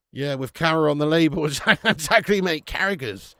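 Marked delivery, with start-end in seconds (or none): none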